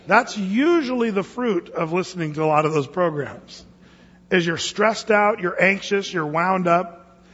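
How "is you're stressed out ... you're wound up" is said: ordinary talk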